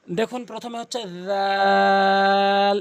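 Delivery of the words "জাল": জাল as dhaal, vowel held very long and loud; three-alif stretch. Shown as a demonstration. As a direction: stretch the middle of জাল slowly for three counts. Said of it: "এভাবে তিন আলিফ".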